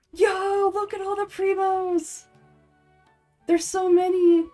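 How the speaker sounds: high, drawn-out wowed exclamation; loud, slow marvelling at a treasure pile; amazed excitement. Real, not acted. Streamer seeing all the primos, amazed.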